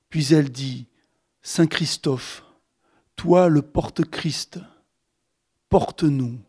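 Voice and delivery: solemn, measured recitation of a litany; incantatory reading of saints' names, steady and deliberate. Acted. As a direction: read it like a prayer, each invocation set apart by pauses. Author reads a litany aloud.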